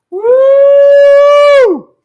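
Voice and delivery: long, high-pitched "woo"; loud whoop. Real, not acted. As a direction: let out a sustained, piercing whoop. Overheated and fired up, lets it out.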